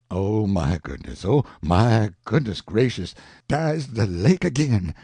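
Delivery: yelled. Acted. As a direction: call out the sighting in alarm. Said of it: "yelled Jim".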